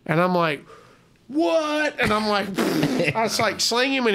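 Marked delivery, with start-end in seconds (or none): 1.3-2.0 higher, stunned yelp of disbelief; retold shock, pitched up; shocked outcry. Man just bitten on the elbow, high-pitched shock.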